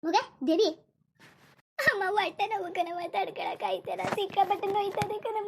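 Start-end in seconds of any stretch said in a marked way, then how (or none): 1.8-5.5 slow, tearful pleading with pain; crying in pain. Acted. Daughter in labour crying slowly to her mother about the pain. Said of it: "ಹೊಟ್ಟೆ ನೋವು ಅಂತ ಅಳ್ತಾ ಕೂತವಳೆ".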